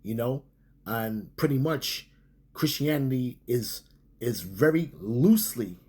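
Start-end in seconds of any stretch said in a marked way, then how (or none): 4.4-5.8 "loosely" drawn out slowly with a pitch swoop; stress on loosely. Real, not acted. Preacher qualifying Christianity's link to scripture, stressing "loosely". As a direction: lean hard on "loosely", slowing down. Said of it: "I have to really stress the word loosely".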